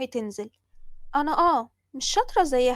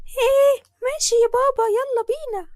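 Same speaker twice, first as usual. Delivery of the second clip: put-on very high, loud happy child voice; little girl excited to play with dad; delighted little girl; 11 semitones higher, 8 dB louder than usual. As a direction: cheer as dad picks her.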